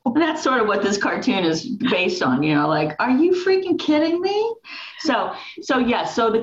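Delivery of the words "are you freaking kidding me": much higher, incredulous mock outrage; high, disbelieving mock outrage; mock exasperation. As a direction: play the worn-out mom who can't believe it. Mom's exasperated reaction to the husband.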